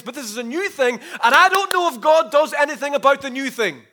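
high, loud, building plea; anxious doubt. Acted. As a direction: preach it as a believer's urgent worry. Voices an anxious, doubting believer.

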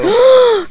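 sharp shocked gasp. Real, not acted.

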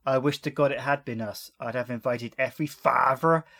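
put-on a slipping West Country accent. Host quotes the coroner, drifting West Country. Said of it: "gone into more like West Country there".

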